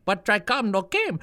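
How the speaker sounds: ordinary talk, nothing marked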